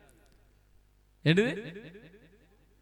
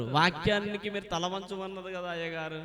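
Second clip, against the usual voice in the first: imitated objectors; 2 semitones higher, 11 dB quieter, faster than usual. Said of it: "కొంతమంది చూస్తారు".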